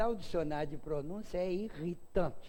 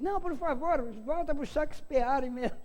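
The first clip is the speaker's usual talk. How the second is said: much higher and louder, begging to stop; pleading heckler; 11 semitones higher, 6 dB louder than usual. Retold or imitated. The heckler begs the speaker, high loud pleading. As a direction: beg him to go back, pitched way up. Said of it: "Aí ele disse".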